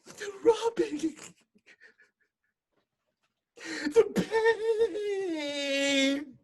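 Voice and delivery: high, slow crying lament; old woman's anguished cry. Retold or imitated. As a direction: wail it through tears. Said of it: "She keeps saying".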